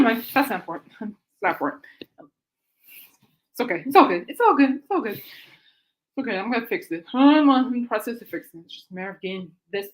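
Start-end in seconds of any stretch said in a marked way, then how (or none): 3.9-5.2 louder, higher, calling out it's all good; repeated loud reassurance; raised reassurance. Real, not acted. Reassuring someone off-mic. 5.2-6.4 slow, soft it's okay; drawn-out calming okay; soothing. Real, not acted. Reassuring someone off-mic.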